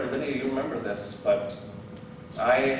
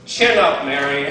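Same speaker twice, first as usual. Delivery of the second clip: mock cheery pep talk; 9 dB louder than usual. To a grieving listener, loud mock-cheery chin up. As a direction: throw out a hollow upbeat pep line. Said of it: "the last thing you want to hear is".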